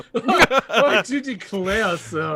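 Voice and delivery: laughing, slow Southern gentleman drawl; genteel Southern accent through laughter. Pretend. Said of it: "where did the, uh, Southern gentleman accent come from?".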